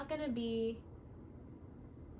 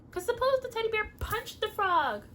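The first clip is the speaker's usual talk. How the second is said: animated; 12 semitones higher, 8 dB louder than usual. Acted. Excited puppet story telling.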